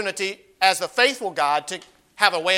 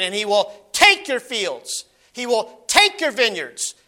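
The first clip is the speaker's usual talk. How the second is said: "take" drawn out and weighted; stress on take; slower than usual. Shown as a demonstration. Preacher reading Samuel's warning, slow stress on "take". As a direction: slowly lean on "take". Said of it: "I'm gonna emphasize a word".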